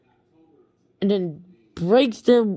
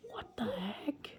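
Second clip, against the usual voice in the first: much softer, whispered confusion; 19 dB quieter than usual. Retold or imitated. Boy stunned by the camera kid, soft whisper. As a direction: whisper it in disbelief.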